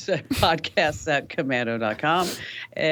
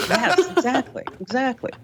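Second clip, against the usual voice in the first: high-pitched laughing yes, exactly; 10 semitones higher than usual. Real, not acted. Cohost laughing along at the Gunther joke. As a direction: laugh through the words.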